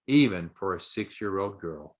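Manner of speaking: ordinary delivery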